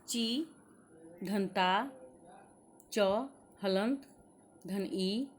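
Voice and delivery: slow, deliberate letter-by-letter breakdown; teacher breaking a word into letters for children; spelling out sounds. Shown as a demonstration. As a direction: sound out each piece for the class. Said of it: "चीता में देखिए".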